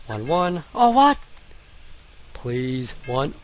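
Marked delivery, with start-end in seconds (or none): 0.7-1.2 loud, high-pitched disbelief; incredulous frustration. Real, not acted. Gamer failing the same sequence, incredulous. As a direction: protest sharply, voice jumping up.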